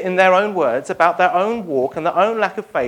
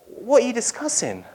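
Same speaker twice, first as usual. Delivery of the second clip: gentle question; 5 semitones higher than usual. Pretend. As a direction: ask kindly as a stranger. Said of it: "Jesus comes and says".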